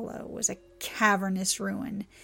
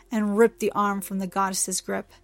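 ordinary delivery

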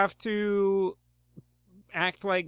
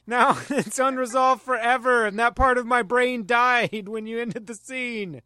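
loud, drawn-out mock despair; mock anguish; 4 semitones higher, 7 dB louder, slower than usual. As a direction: wail it like a lost thought. Mock distress over ended scene.

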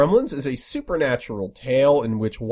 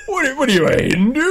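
mocking bigot; 12 semitones higher, faster than usual. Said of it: "what does he say?".

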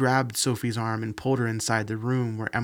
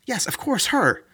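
impatient; 12 semitones higher, 5 dB louder than usual. Acted. Amanda impatient, insisting.

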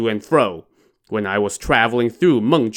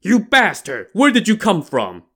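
roaring; 9 semitones higher than usual. A narrator's reading. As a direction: bellow it at the stranger. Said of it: "and roared".

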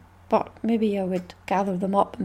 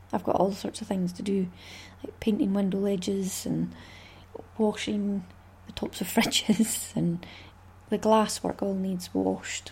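softer unvoiced whisper; 2 semitones lower, 5 dB quieter than usual. Real, not acted. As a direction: whisper the chores like a confession. Whispers list of chores.

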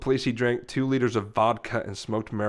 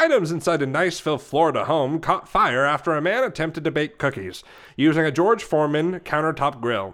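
quicker, punchy put-on news read; newscaster voice; 2 semitones higher, 3 dB louder than usual. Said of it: "read it like a newscaster".

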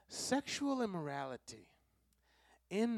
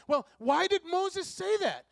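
high, loud mock voice of a puzzled crowd; questioning crowd; 8 semitones higher, 6 dB louder than usual. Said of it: "the people that are talking to Jesus are saying".

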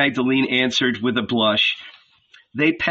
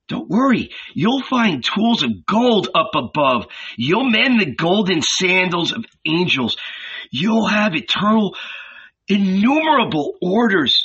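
higher, breathless with wonder; excited Philip; 6 semitones higher than usual. A narrator's reading. Philip tells Nathanael the world is ending, awed and urgent. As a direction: pour out the marvels, awed and hurried.

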